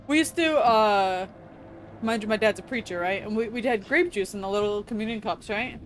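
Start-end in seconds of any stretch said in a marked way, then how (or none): none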